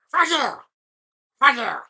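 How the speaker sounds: loud, high jokey curse; mock curse. Real, not acted. Streamer jokingly cursing a heckling chatter, loud. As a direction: fling it at the chat.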